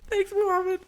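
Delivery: very high-pitched, loud thanks; hammed-up high voice, backing off politely; acted sheepish thanks. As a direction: squeak a mock-embarrassed thank-you. Acted embarrassed thanks to guard.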